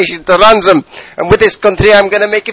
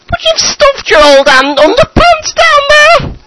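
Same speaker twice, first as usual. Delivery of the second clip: put-on high, loud, wailing old mother voice; nagging mother; 4 dB louder than usual. Mother scolding Saddam at midnight, high wailing nag. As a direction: screech it out as his fed-up mum.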